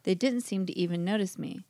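usual speaking voice

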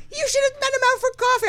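very high, fast mock nagging; squeaky, hurried retold advice; imitated insistent friend. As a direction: nag quickly in a high voice. Voices friend scolding her.

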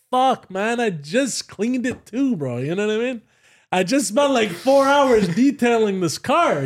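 high-pitched, drawn-out complaint; mock lament. Driver looking at his burning car, exasperated lament.

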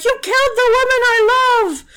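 high, loud anguished yell at the killers; furious shout. Retold or imitated. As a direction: scream it out in grief and rage. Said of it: "Samson got even more mad".

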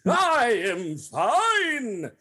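slow grand melodrama voice; hammy stage actor. Acted. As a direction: overact it like a Victorian stage hero. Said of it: "Overacting, not a thing".

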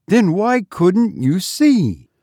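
slow, high insistent question; demanding. A narrator's reading. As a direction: press harder for an explanation. Mistress keeps interrogating the maid.

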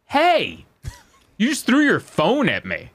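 mock indignant woman, high pitch; indignant outburst. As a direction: act out an offended passenger calling out. Retelling an angry woman's outburst.

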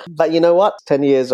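bright, loud rising question; spring up with enthusiasm; excited lift. Real, not acted. Upbeat turn in story.

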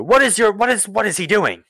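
very high, loud, flustered demand; outraged Duke. Acted. Duke turns to the four companions, loud and outraged. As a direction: stammer out an indignant shout. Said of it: "he turns to the four of you".

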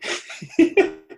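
high burst of laughter. Real, not acted. Laughs at the host.

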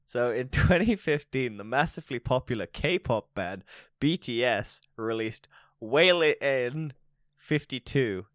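ordinary talk, nothing marked

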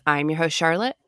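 ordinary talk, nothing marked

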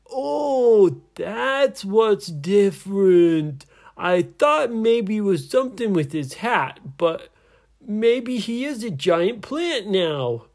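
high, loud comic pirate voice; stretched, dim-sounding realisation; slow-witted pirate. Acted. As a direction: puzzle it out loudly from up in the branches. Dim pirate calls down from plant.

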